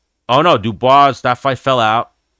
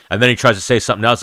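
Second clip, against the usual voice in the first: usual speaking voice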